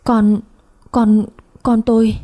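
halting, drawn-out stammer of shock; tongue-tied. A narrator's reading. Panicked mother trying to answer her neighbours, tongue-tied. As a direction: struggle to get each word out. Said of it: "ngọng cả miệng, không nói được thành lời".

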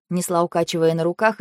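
ordinary delivery, nothing marked

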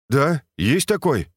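brisk and upbeat; cheerful voice. A narrator's reading. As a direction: say it with cheerful energy. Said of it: "бодрым голосом сказал Юрген".

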